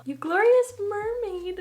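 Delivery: high, slow, savoring the card; reading out, charmed. Real, not acted. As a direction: read the card with delight. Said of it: "it says on the front".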